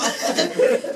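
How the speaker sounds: high unvoiced laugh; real laughter. Real, not acted. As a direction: burst out laughing. Laugh after sister quote.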